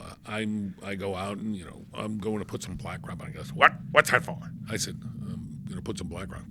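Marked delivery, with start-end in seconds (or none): 3.5-4.4 sharp high "what's that for"; retold startled bark of a question; startled challenge. Retold on-set challenge from Lloyd. 5.0-6.5 breathy under-the-breath answer; retold sheepish whisper; whispered reply. Retold muttered reply to boss.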